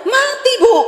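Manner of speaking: high, matter-of-fact widow voice; imitating the widow. Preacher voicing the bitter widow in counselling, high and blunt. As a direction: answer like a plain-spoken widow.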